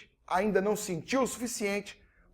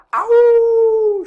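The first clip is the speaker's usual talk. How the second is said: dog howl; 14 semitones higher, 13 dB louder than usual. Retold or imitated. Dog howling while crying.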